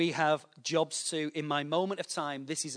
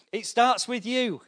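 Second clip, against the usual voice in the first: louder and slower, higher pitch; forceful preaching; 8 semitones higher, 9 dB louder, slower than usual. Real, not acted. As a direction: hammer it home to the congregation. Preacher building to climax.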